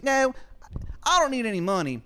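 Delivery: very high, loud, quick falsetto; Shithead Steve asking. Acted. Steve asking Adam for a favor, fast high falsetto. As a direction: rush the request up high. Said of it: "He goes".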